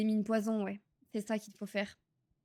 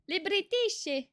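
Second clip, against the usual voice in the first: attempted British accent; 8 semitones higher, 4 dB louder, much slower than usual. Shown as a demonstration. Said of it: "l'accent british".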